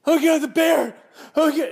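very high, loud mock scream; prankster's false alarm. Prankster daughter fakes a bear sighting, mock scream. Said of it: "she comes running in 'cause she's the prankster".

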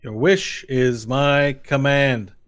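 grandly obedient minion; servile henchman. Acted. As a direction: declare obedience word by drawn-out word. Game master voices servant Laszlo.

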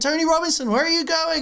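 very high, loud teacher's call. Retold or imitated. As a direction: call after a runaway pupil. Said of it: "She went".

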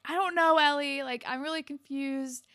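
mildly hammed-up confusion; mini crisis. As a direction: imitate a boy in a little panic. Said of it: "Marco has a little crisis".

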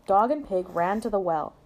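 ordinary delivery, nothing marked

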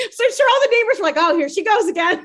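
loud, high, talking through laughter; laughing. Real, not acted. Teasing herself about crying past neighbors, loud laughing. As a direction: crack up while telling it. Laughing at her daily-cry story.

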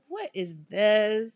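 amazed question. Retold or imitated. Clubber retelling seeing roped-off VIP sections, amazed. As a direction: stretch it out in retold amazement.

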